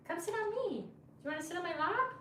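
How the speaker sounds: very high coaxing coo. Real, not acted. Inviting her puppy onto her lap, high baby talk.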